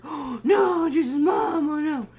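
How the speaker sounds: high, loud hammed-up dread; mock dismay. Host imitating horrified viewers who know the killer, mock dismay. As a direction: cry out in exaggerated alarm. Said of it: "they're like".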